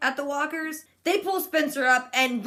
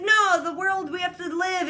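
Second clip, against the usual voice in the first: urgent pleading; 8 semitones higher than usual. Retold or imitated. Glenn begging Enid to keep going, high urgent plea. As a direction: beg her not to give up.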